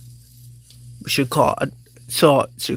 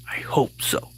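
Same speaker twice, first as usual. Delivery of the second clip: whispered aside; 5 dB quieter than usual. Acted. Muttered aside.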